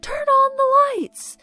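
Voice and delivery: high, loud, slow chant-like yell; screaming again. Retold or imitated. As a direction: keep yelling it slow and loud at the houses. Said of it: "they start screaming".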